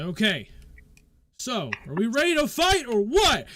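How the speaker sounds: loud, high, impatient call; impatient shout. Acted. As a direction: hurry them up loudly. Impatient fighter calls out.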